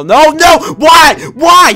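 high, loud wailing why; anguished shout. Real, not acted. Gamer shouting after being knocked out of his hiding hole. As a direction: shout in real disbelief.

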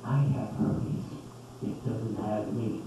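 slow, low, soft ad slogan; hammed-up solemn commercial tagline; deep announcer closing a herpes commercial; slogan voice-over. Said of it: "and then there's slogan".

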